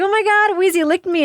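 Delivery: thrilled dog lover gushing about the lick; excited squeal. Retold or imitated. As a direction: squeal it like a delighted dog fan.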